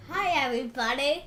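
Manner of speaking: Doctor Nick. Acted. Friend copying the demonstrated hello, imitating Doctor Nick. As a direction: copy the exaggerated cartoon hello, loud and high. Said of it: "Doctor Nick on The Simpsons".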